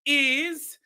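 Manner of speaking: measured imitation of Shaba's accent, word by word. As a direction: declare it emphatically in Shaba's accent. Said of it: "keep this accent all night".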